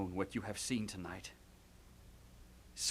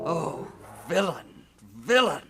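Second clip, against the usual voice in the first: loud outraged shout; furious cry; 5 semitones higher, 10 dB louder than usual. Acted. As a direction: hurl the word at him. Hamlet rages at uncle.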